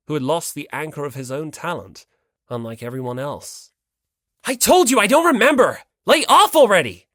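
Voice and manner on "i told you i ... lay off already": yelling, much higher and louder; angry outburst at a pestering classmate; furious shout. Acted. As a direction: blow up and shout him down. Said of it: "slammed the table, overcome by emotion".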